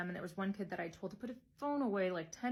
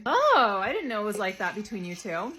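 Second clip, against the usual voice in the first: mock surprise; 8 dB louder than usual. Teacher teasing two colleagues who keep touching, mock surprise. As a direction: fake shock to tease them.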